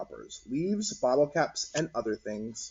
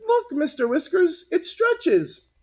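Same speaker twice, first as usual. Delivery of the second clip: put-on very high, excited kid; child voice; 5 dB louder, slower than usual. Ashley showing the teacher, very high child voice. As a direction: show it off like a proud girl.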